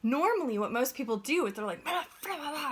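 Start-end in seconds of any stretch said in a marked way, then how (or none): 1.9-2.7 higher, dismissive blah-blah babble; mocking grumble. Mocking drivers who complain after being cut off, high blah-blah babble. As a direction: toss off the complaint like meaningless noise.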